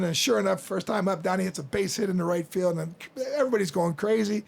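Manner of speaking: usual speaking voice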